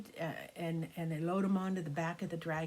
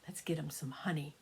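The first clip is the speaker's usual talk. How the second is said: softer, lower whisper of a clever plan; whispered secret idea; 5 semitones lower, 6 dB quieter than usual. Acted. Desmond shares secret idea.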